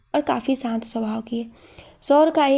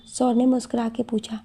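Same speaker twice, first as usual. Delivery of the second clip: ordinary delivery, nothing marked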